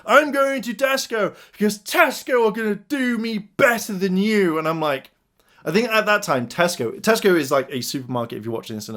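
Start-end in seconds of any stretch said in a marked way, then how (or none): 0.1-4.6 high-pitched parting rant; mock shouting. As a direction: imitate an indignant customer storming off. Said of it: "started shouting when he left".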